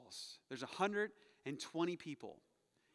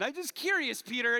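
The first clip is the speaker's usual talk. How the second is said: cheeky aside; 6 semitones higher, 7 dB louder than usual. Pretend. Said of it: "I'm the guy asking the question".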